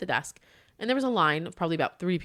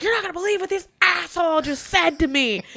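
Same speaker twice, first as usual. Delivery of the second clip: fuming; 11 semitones higher, 4 dB louder than usual. Retold or imitated. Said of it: "see steam coming out of her ears".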